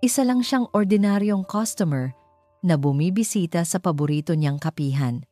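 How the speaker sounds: ordinary delivery, nothing marked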